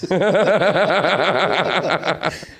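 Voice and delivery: loud burst of laughter; real laughter; laughing. Real, not acted. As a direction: laugh at own joke. Laugh after punchline.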